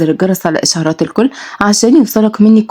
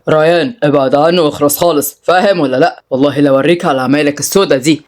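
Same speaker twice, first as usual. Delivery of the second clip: usual speaking voice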